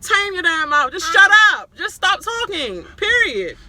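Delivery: high, loud shut up; fed-up shout. Real, not acted. Vlogger yelling at the houseguest to shut up, furious and high. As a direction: yell at her to stop talking.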